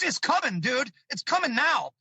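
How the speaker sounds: ordinary talk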